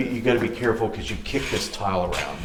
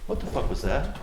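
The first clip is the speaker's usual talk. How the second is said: startled; 6 semitones higher than usual. Real, not acted. Sudden noise heard.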